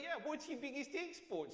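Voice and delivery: a hint of an Aussie mate asking; Australian accent. Aussie flatmate repeating his question, light Australian accent. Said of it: "he's like".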